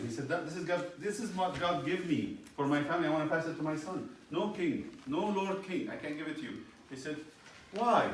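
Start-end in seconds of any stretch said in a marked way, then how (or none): 0.3-4.1 lightly raised, pleading subject's voice; humble man refusing the king, higher; pleading Naboth. Acted. 4.3-6.6 higher, pleading no to the king; humble refusal. Acted. 7.7-8.1 high, loud demanding why; king demanding Naboth's reason, loud and high. Acted.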